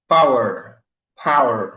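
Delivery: power as paw-er, stress on the first part; model word. Shown as a demonstration. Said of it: "je cherche un mot très courant". (power prononcé pawer, à l'anglaise)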